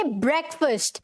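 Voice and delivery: ब्रेकफास्ट said "BREK-fust"; short vowels in both halves of ब्रेकफास्ट; correct pronunciation. Shown as a demonstration. Teacher modelling for students, ब्रेकफास्ट as "BREK-fust". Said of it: "क्या लिखेंगे?".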